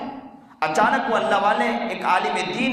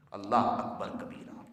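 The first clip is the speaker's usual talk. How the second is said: much softer, lower murmur of praise; hushed awe; 12 dB quieter than usual. Real, not acted. Preacher overcome before the congregation, hushed. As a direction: breathe it out in awe. Awed exclamation after revelation.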